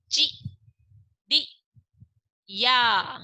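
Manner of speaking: chi, di, ya broken into separate syllables with pauses; syllable-by-syllable reading; sounded out by syllable. Shown as a demonstration. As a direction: spell the word out slowly, syllable by syllable. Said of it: "क्या बना?".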